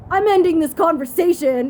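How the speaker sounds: loud, firm mock declaration; imitating a huffy, loud walkout; retold indignant exit. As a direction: announce it like storming off. Retold angry exit from argument.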